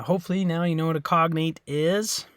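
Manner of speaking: normal talking voice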